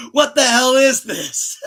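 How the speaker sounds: high, loud panicked yell. Retold or imitated. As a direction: yell it in shock at the mirror. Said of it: "He'd be like".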